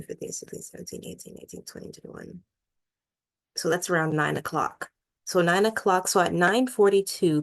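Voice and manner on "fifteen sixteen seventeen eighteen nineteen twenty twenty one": counting in a whisper. Real, not acted. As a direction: count it off under your breath. Counting hours under her breath.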